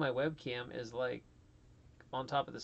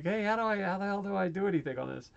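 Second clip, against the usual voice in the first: higher, louder, fast exasperated asking for help; exasperated plea; 5 semitones higher, 6 dB louder, faster than usual. Retold or imitated. Streamer imitating himself pestering friends about the new PC, exasperated. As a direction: play yourself begging your friends for help.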